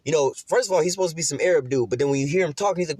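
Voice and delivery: normal talking voice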